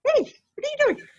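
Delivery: high, fast, startled outburst; a quick surprised yelp at a cow; startled hey. Retold or imitated. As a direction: blurt it out, caught off guard. Startled by cow blocking path.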